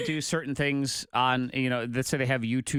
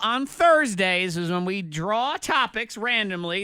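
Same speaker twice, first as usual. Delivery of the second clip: slow, raised host voice; show announcer; 4 semitones higher, 5 dB louder, slower than usual. Acted. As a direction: introduce the battle like a host. Announcing the rap battle.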